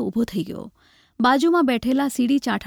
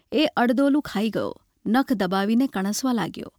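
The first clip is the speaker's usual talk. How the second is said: ordinary delivery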